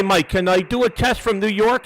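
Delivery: ordinary talk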